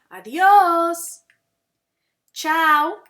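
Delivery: loud, high, drawn-out goodbye words; repeat-after-me model. Shown as a demonstration. Teacher showing how to say goodbyes, loud and drawn-out. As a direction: call each word out for learners to copy.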